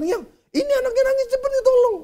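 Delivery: high-pitched, hurried plea to a wife; mock panicked scolding. Panicked husband scolding his wife over the crying baby, mock frantic. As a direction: reenact a new father fussing in panic. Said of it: "Saya marahin istri saya".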